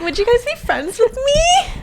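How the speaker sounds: very high, put-on eager little voice; mock eager plea. Sister mocking her own excited question, high and eager. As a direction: squeal the question in mock hope. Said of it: "I was like".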